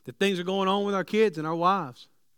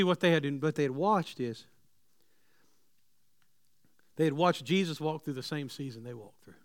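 ordinary talk, nothing marked

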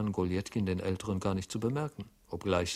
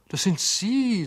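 feigned surprise; 13 semitones higher, 8 dB louder, slower than usual. Acted. Feigned recognition.

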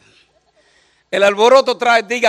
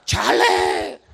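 mock prophet voice. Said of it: "como dijo el profeta".

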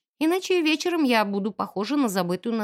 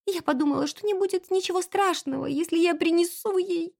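put-on high, plaintive young maid; tearful; 6 semitones higher than usual. A narrator's reading. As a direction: excuse herself, close to sobbing. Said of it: "Она всхлипнула". (жалобно, сквозь слёзы)

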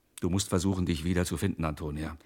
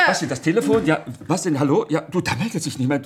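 loud, high, flustered; 6 semitones higher, 7 dB louder than usual. Acted. Husband flustered, grabbing the phone, loud and rattled. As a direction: blurt it in a panic.

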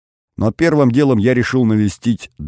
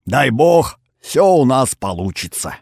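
put-on high, creaking old grandfather toast; very old man; 7 semitones higher, 3 dB louder than usual. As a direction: croak it out like an old man raising a glass. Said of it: "прокряхтел дед".

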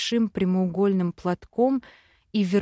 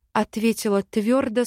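ordinary delivery

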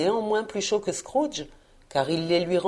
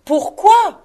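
very high, loud baffled cry; exclaiming; 13 semitones higher, 9 dB louder than usual. A narrator's reading. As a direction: demand it in a high-pitched outcry. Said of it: "s'écria son neveu". (cri d'incompréhension très aigu)

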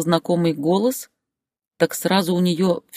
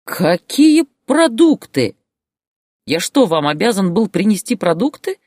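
outraged; 7 semitones higher, 5 dB louder than usual. A narrator's reading. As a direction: explode in disbelief at her. Husband's outraged answer in quarrel. (возмущённый крик)

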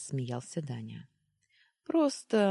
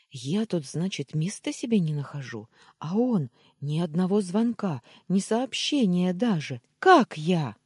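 rattling off; 5 semitones higher, 8 dB louder than usual. Acted. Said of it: "затараторила".

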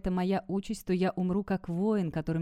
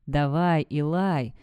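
plain reading voice

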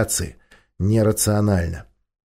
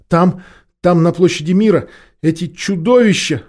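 panicked; 8 semitones higher, 5 dB louder than usual. Acted. Official panics seeing monsters.

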